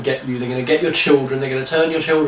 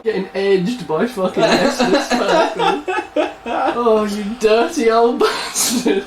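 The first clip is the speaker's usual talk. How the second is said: laughing mock outrage; 6 semitones higher, slower than usual. Real, not acted.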